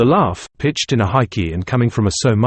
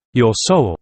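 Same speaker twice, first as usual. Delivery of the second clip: usual speaking voice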